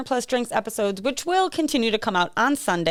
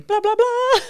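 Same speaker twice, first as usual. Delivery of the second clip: mocking blah blah; 11 semitones higher, 4 dB louder, slower than usual. Mocking repeat of partner.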